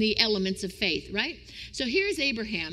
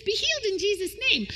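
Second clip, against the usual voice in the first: healing preacher; 10 semitones higher than usual. Pretend. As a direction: declare healing like a tent revivalist. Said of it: "I want to be the kind of guy that says".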